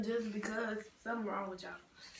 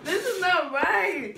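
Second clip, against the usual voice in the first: loud pained protest; 7 semitones higher, 8 dB louder, slower than usual. Real, not acted. Reacting to spicy wings.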